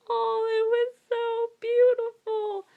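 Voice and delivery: loud, high, drawn-out swooning wail; high emotional fangirl cry; overwhelmed gushing. Real, not acted. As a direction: gush slowly and loudly, totally overcome. Gushing over a moving book moment.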